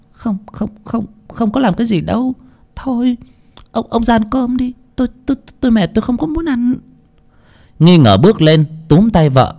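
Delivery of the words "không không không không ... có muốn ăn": high, stammering wife's voice; stammering denial. A narrator's reading. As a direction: stutter out a nervous denial. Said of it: "lắp bắp". (giọng cao lắp bắp chối quanh)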